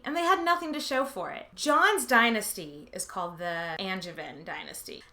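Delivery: normal talking voice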